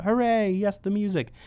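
high, loud mock cheer; pretend crowd shout. Host voicing listeners' answer, mock shouted cheer. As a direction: cheer it out as the audience would. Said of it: "so everybody could shout".

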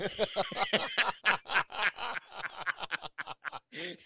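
a long real laugh at his own punchline; hearty laugh. Real, not acted. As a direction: laugh it out freely.

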